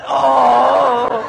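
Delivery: usual speaking voice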